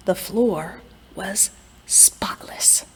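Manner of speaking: whisper. A narrator's reading. Quiet house scene.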